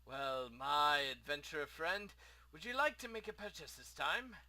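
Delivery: lightly voiced eager merchant; a hint of chatty shop owner; friendly shopkeeper. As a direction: greet a customer with mild theatrical lift. Plays the shopkeeper.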